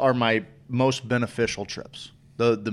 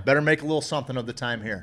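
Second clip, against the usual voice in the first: fast repeat; much faster than usual. Retold or imitated. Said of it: "I'm like".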